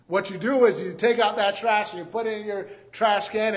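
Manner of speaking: normal talking voice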